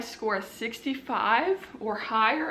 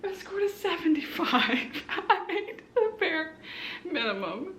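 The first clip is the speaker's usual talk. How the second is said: laughing; 6 semitones higher than usual. Real, not acted. Laughs at minimal score.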